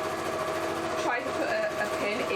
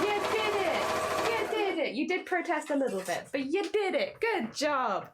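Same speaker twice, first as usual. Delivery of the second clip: delighted praise; 6 semitones higher than usual. Real, not acted. Sewist congratulating the machine that protested but managed, delighted praise. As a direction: coo proudly over a little triumph.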